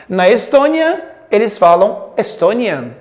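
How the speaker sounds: ordinary talk, nothing marked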